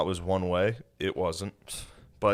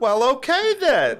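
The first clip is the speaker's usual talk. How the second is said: put-on squeaky old lady; 7 dB louder than usual. Old woman voiced in story.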